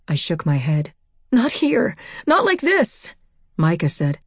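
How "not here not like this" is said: high-pitched, pleading refusal; anguished high voice begging off; distressed refusal. A narrator's reading. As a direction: protest desperately, pitched very high.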